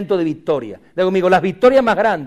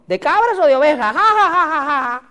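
high, jeering question into fake laughter; hammed-up derisive guffaw; mocking laughter; 10 semitones higher than usual. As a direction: ridicule them and cackle at it. Acts out someone mocking a pastor.

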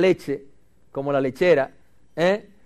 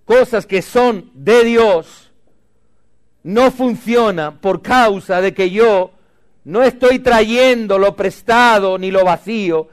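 loud, slow, higher preaching; loud, deliberate sermon build; emphatic preaching; 4 semitones higher, 5 dB louder than usual. Real, not acted. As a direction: drive each phrase home slowly. Pastor preaching emphatic point.

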